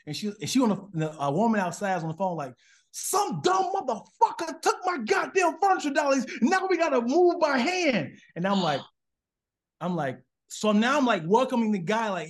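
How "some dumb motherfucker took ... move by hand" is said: angry shout. Retold or imitated. Angry woman on phone retold.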